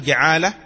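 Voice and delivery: جعالة said with an open a after the jim; جعالة بفتح الجيم; ja'ala with fatha. Shown as a demonstration. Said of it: "بفتح الجيم وكسرها وضمها".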